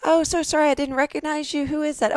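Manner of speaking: overly polite shopper apologizing to an acquaintance; mock polite apology. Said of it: "I could be in Publix and shop and say".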